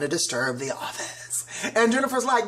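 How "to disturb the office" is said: whisper. Retold or imitated. Melissa quoted asking for room.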